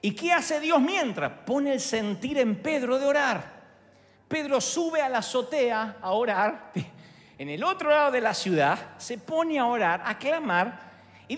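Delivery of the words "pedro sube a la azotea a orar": usual speaking voice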